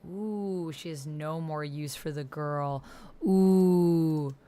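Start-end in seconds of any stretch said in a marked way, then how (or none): none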